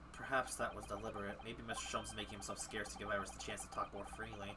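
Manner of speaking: soft whispered inner thought. Acted. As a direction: whisper it like a secret aside. Reading the hero's inner thought.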